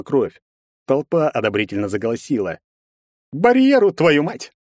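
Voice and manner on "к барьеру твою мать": loud, much higher mocking call to the barrier. A narrator's reading. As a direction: jeer at him for the crowd. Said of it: "насмешливо произнёс Герцен". (насмешливо, громко)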